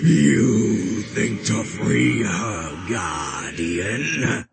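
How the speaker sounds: loud, slow snarling monster. A narrator's reading. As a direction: snarl the challenge slowly. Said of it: "It snarled".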